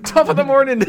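high, loud mock-Irish greeting; hammed-up Irish YouTuber opener; Jacksepticeye. Shown as a demonstration. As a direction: shout it in a put-on Irish voice. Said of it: "the Jacksepticeye thing".

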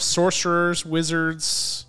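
usual speaking voice

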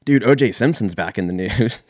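ordinary delivery

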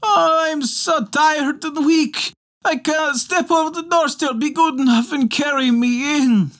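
very high, wailing little creature voice; howling, pleading mannikin. Acted. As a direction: whine like a pitiful cold dwarf. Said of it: "But Mannikin Longbeard said".

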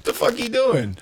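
very high, incredulous squeal; amused bafflement. Retold or imitated. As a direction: pitch way up, half-laughing in disbelief. Retold baffled reaction, amid laughter.

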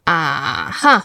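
Ah-huh starting very low, lifting on huh; "Ah-huh" with a deep first part; low ah, rising huh. Shown as a demonstration. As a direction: sink on "Ah", then lift "huh". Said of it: "It's very low in your voice".

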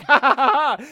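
loud, high mock villain cackle; villain laugh. Villain gloating over trapped Perry, loud mock cackle. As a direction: cackle like a triumphant cartoon villain. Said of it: "and he's like".

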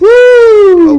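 high, loud whoop; cheer. Acted. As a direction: whoop for the new year. New Year cheer.

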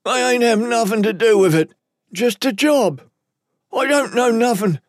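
high-pitched, panicky captive voice; frightened lowlife. Acted. Captive pleading with his interrogators, high and frightened. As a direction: protest your innocence in a high voice.

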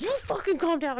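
high-pitched angry retort; retold yelling. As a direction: fire back in mock outrage. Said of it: "while I'm yelling all these things".

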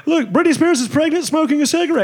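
high-pitched gossip reporter yelling; mock paparazzo. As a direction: hype it up like a tabloid cameraman. Mocking TMZ paparazzo calls.

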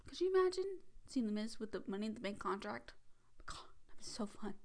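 breathy hushed gush about the Miz; excited whisper. Real, not acted. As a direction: whisper it like a giddy secret. Excited secret aside to viewers.

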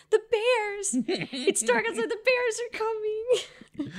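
imitating a hysterical child crying out; mock terrified child. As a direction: act out a sobbing little boy. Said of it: "hysterical crying fit and is screaming".

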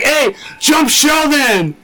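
high-pitched, drawn-out mock call; imitated rallying shout. Someone sets everybody on Sheldon, high mock shout. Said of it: "someone was like".

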